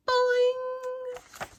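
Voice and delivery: long high cartoon boing; bouncy high boing sound. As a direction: stretch it like a spring.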